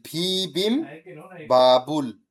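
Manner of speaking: two-part drill of "ভি বিম ... বাবুল"; separated syllables. Shown as a demonstration. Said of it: "আমি মাত্র সিলেবলটা আলগা করে দিছি".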